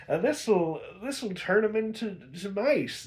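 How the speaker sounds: a hint of a cocky witch bragging about her potion; witch aunt boasting.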